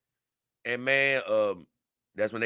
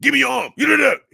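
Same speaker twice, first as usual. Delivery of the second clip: a raised mock shout, higher and louder; retold officers yelling; 13 semitones higher, 9 dB louder, faster than usual. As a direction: bark the order like an angry cop. Officers grabbing the man, retold.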